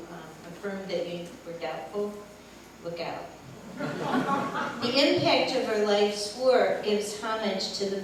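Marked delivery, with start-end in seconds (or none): 3.3-4.8 a short airy chuckle. Real, not acted. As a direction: laugh lightly at the memory. Laughs at her own remark.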